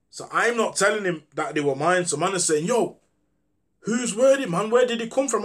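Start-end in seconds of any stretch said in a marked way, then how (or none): 2.6-5.4 retold demand, much higher and louder; mild mock anger, pressing for answers; angry friend. Angry house owner questioning the speaker about the bullets, mildly mock angry. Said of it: "he was pissed".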